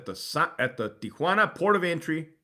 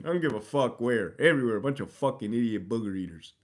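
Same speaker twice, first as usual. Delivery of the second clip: normal talking voice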